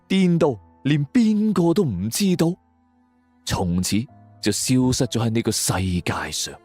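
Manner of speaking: ordinary delivery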